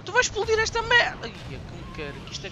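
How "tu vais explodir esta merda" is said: loud, high alarmed yell at the game; shouting in alarm. Real, not acted. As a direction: shout it out as the station is about to blow. Gamer panics at exploding station.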